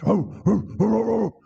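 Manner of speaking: quick high yelping barks; imitated dogs. Sheriff's dogs at the tree, imitated quick barking. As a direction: yip rapidly like a pack of hounds. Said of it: "gets there with the dogs".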